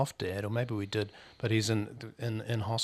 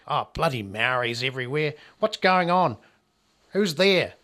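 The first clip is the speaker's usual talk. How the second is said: put-on gruff old bloke, shouting higher and louder; 4 semitones higher, 8 dB louder than usual. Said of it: "the old generation redneck kind of thing".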